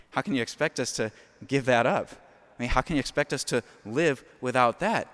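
a lightly voiced scoffing churchgoer, laughing; hint of a laughing, disbelieving layman; mock incredulous parishioner. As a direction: play the skeptic who thinks the priest is crazy. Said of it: "someone looks at me like I'm crazy".

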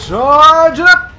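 high, loud, drawn-out battle cry; hyped attack calls. Real, not acted. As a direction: stretch it out as a big strike builds. Calling out big attacks.

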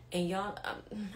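ordinary delivery

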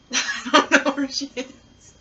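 talking through real laughter; helpless giggle, words dragged out by laughing. Real, not acted. Can't find spoon while blindfolded.

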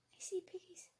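soft scared whisper; frightened whisper. Real, not acted. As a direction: whisper so the piggies don't hear. Spotting monsters, whispering.

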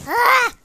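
short, loud, high cry; frustrated yelp. Real, not acted. Player failing at a hard game, frustrated yelp.

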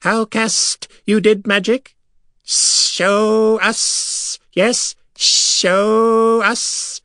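high, slow, eager alien voice; high eager creature voice. A narrator's reading. Silver Sands begging the wizard to show magic.

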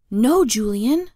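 urgent hushed shout, drawn out; whisper scream. A narrator's reading. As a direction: stretch it out as a stifled scream. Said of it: "I whisper screamed".

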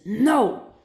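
usual speaking voice

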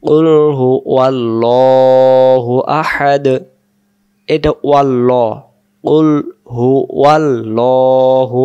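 slow, melodic Quran recitation; full recitation. Shown as a demonstration. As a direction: recite the whole surah slowly and melodically. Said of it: "শুরু থেকে বলে দিই".

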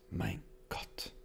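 hushed, voiceless whisper; soft, airy whisper from the wife. A narrator's reading. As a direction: whisper it under the breath. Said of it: "flüsterte seine Frau".

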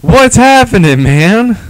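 high, loud, slow cartoon greeting; hammed-up high voice, loud and drawn out; cartoon character impression. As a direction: greet a buddy like a cartoon kid. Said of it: "whoever does, like".

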